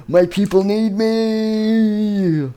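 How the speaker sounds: high, long stretched-out call; mock-heroic farewell wail; dramatic exit cry. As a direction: hold the last word like a departing hero. Fugitive hero makes dramatic exit.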